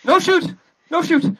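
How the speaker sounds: high frightened begging; panicked pleading. Acted. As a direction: plead with the gun on you. Man begging at gunpoint.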